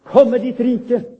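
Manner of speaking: loud, high, slow cry of praise. Acted. Congregation answering God's promise, loud and slow. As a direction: call it out like the whole church answering. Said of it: "Og vi roper".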